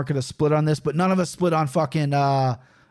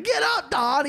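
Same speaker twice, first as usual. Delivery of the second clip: imitated scolding mom; 10 semitones higher than usual. Mom to stepdad knocked down by her son, high mock scolding. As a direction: imitate an exasperated mother, pitched way up.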